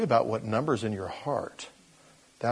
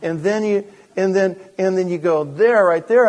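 loud, raised, repeated pointing-out; exasperated insisting; 7 semitones higher, 7 dB louder than usual. Acted. Owner shows the cat where the tuna is, loud exasperation. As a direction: insist louder and higher, as to someone clueless.

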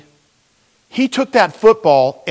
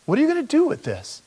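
fast, quieter, questioning plea; pleading prayer; 3 semitones higher, 4 dB quieter, faster than usual. Retold or imitated. Man asking God about the destitute, fast pleading. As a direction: ask the Lord urgently. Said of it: "I said".

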